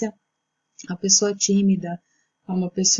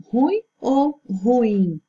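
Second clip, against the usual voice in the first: ruim first as RU-im, then ru-IM, slow and higher; stress contrast; 3 semitones higher, much slower than usual. Shown as a demonstration. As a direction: slowly weigh ruim against ruim, moving the stress to the i. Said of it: "A forma correta é ruim".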